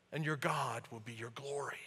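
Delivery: hushed whisper of awe. Acted. Preacher reading Isaiah to the congregation, whispered. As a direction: breathe the words in a whisper.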